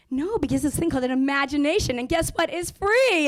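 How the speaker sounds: mockingly triumphant, building to free; sassy comeback. Mom jabbing at bully mom.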